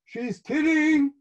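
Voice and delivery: high, loud, drawn-out cry; embarrassed kid calling out, high and slow; loud protest. A narrator's reading. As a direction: announce it loudly to everyone listening. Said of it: "I say loudly".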